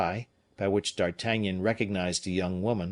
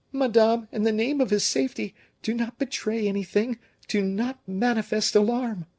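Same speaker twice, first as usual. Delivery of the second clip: high, fast, held-down pleading voice; calm her without raising alarm; urgent plea; 12 semitones higher than usual. A narrator's reading. Madame de Belliere urges Madame Fouquet to hide her fear. Restraining a panicking woman.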